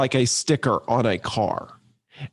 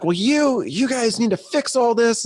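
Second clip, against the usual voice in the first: high, raised voice of an annoyed customer; retold irate caller, pitched up; upset customer; 8 semitones higher than usual. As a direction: imitate the caller demanding a fix. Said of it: "and he gets really, really upset".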